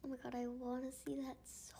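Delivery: fast unvoiced whisper; eager aside, fast and whispered. Real, not acted. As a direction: whisper it quickly like a secret.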